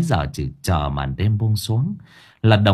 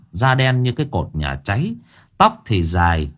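plain reading voice

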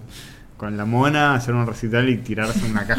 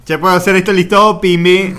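mock careless guy; 7 semitones higher, 8 dB louder than usual.

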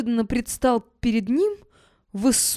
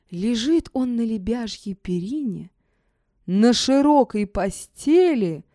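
ordinary delivery, nothing marked

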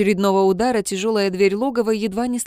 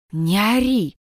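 hushing; slower than usual. A narrator's reading. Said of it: "зашикал на него тот".

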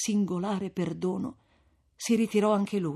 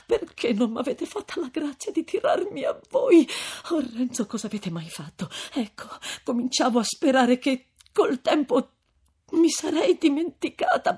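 high, pained female voice; plaintive grief, mostly high-pitched; sorrowful lament; 7 semitones higher than usual. A narrator's reading. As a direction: lament to heaven, then falter. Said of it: "esclamò dolorosamente Lucia".

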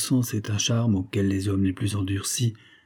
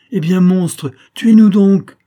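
loud, high screaming defiance; desperate outcry; 10 semitones higher, 13 dB louder, slower than usual. A narrator's reading. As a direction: scream it at him, falling to her knees. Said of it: "s'écria-t-elle". (cri désespéré et strident)

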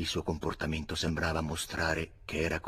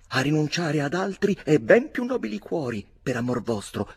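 acuta e più forte; sfuriata furiosa; 9 semitones higher, 6 dB louder than usual. A narrator's reading. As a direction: lo rinfaccia gridando in alto. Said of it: "continuava la sua sfuriata con grida sconnesse". (milady rinfaccia il matrimonio, gridando acuta)

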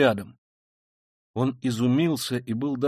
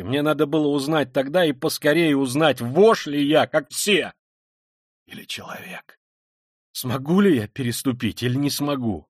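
forceful, rising questions to oneself; tormented self-questioning; 4 semitones higher, 7 dB louder than usual. Acted. Killer testing himself before Sonya, tormented and intense. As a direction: demand the answer from yourself, loudly. Voices Raskolnikov's confession.

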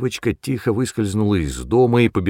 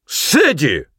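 sharp, higher rebuke; angry exclamation; 6 semitones higher, 4 dB louder than usual. A narrator's reading. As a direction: exclaim the name in anger. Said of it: "гневно воскликнул мистер Трис". (гневный окрик)

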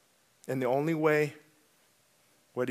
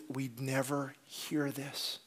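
softer, slow repeated quote; hushed echo; 8 dB quieter, slower than usual. Retold or imitated. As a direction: murmur what the people said.

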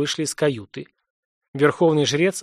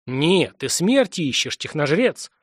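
ordinary delivery

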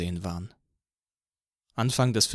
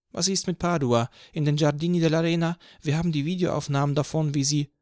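higher accusing cop; officer accuses, higher pitched; policeman confronting; 6 semitones higher, 3 dB louder than usual. A narrator's reading. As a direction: confront him with evidence. Policeman confronts about Padua.

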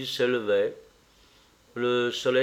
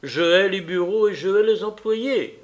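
hard, louder and higher retort; angry retort; 7 semitones higher, 5 dB louder than usual. Acted. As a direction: spit out his hatred of offices. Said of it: "répliqua-t-il". (le fils réplique à sa mère avec colère, plus fort)

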